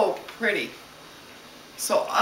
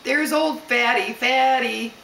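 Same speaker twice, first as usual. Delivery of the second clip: higher, louder mock playground taunt; teasing kids; 5 semitones higher, 5 dB louder than usual. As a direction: jeer like a storybook bully, pitched up. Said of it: "and they goes".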